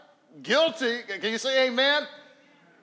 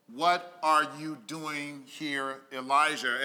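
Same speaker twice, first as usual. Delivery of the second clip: soft, low and slow call; a quiet voice from the cave mouth; hushed voice of God; 6 semitones lower, 4 dB quieter, slower than usual. Acted. God quietly questioning Elijah in the cave, hushed and slow. God's voice reading the verse.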